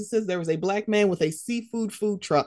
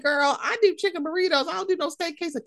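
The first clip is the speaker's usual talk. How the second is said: higher, sassy insistence; sassy protest; 10 semitones higher, faster than usual. Retold or imitated. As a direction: refuse the steak with attitude. Quoting her own inner voice.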